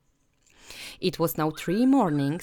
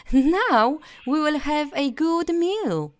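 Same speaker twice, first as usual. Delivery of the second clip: put-on higher, excited boy's voice; eager Hansel; 10 semitones higher, 4 dB louder than usual. Hungry Hansel spotting the house, higher and eager. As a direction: Hansel delighted at the bread house. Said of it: "said Hansel".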